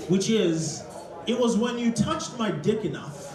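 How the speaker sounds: ordinary delivery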